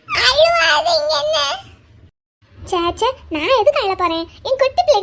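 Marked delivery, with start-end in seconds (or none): 0.1-1.4 put-on high, loud, slow child's voice breaking into a cry; tearful child plea. A little boy begs his friend not to cry, high and tearful. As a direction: plead through tears, stretched out.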